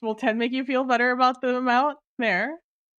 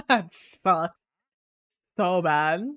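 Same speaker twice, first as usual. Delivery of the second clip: normal talking voice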